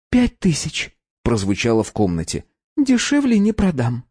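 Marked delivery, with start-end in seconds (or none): 0.1-1.0 bold price. A narrator's reading. Conchita names her price. 2.8-4.0 put-on high, firm young woman; firm refusal. A narrator's reading.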